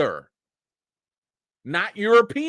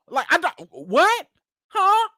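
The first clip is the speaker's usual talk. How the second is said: high, mock-confused "huh?"; exaggerated incomprehension; baffled; 11 semitones higher than usual. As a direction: play totally lost, pitch jumping up. Acts out failing to understand British speakers.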